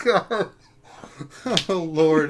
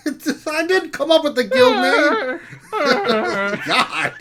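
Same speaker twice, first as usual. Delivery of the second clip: ordinary delivery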